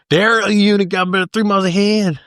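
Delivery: high-pitched, garbled Southern maniac impression; Southern maniac accent. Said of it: "Some kind of Southern maniac accent".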